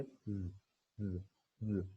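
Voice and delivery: repeated drawn-out z buzz; isolated z sound. Shown as a demonstration. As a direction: buzz z again and again like a drill. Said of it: "ये ऐसे हम इसको बोलते हैं". (बार बार खींची हुई z आवाज़)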